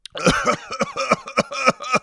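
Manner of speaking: high gurgling bong noise; imitated bong bubbling. Shown as a demonstration. Comic showing listeners what a bong sounds like, high gurgle. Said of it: "That's what a bong sounds like".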